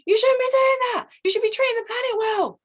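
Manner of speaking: high, loud preachy scolding voice; high mock scold; finger-pointing scold. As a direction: wag your finger and lecture them. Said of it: "pointing the finger going".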